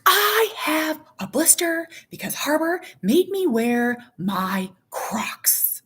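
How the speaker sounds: put-on high, whiny little-girl complaint; hammed-up kid grievance; imitated tattling child. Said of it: "that's how she talks".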